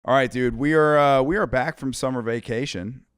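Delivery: loud, pumped-up radio host intro. Acted. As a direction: open the show like a DJ. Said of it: "my radio voice".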